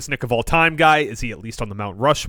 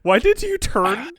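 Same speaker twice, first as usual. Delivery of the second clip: laughing; 8 semitones higher, slower than usual. Real, not acted. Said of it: "turn into the penguin".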